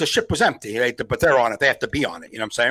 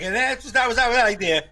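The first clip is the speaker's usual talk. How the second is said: higher, trailing shrug of a bit; dismissive sign-off; 9 semitones higher, faster than usual. Acted. Comic imitating a bombed bit's ending, dismissive. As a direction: wave off your own weak bit. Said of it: "You're like".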